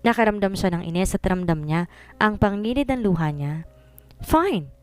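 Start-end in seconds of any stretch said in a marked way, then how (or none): 4.2-4.7 high, sulking cry of fine; sulky shout. A narrator's reading. Ignored by the young man, she cries out in a sulk. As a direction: snap it out like a hurt, pouting girl. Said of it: "Nagtatampo niyang sigaw sa binata".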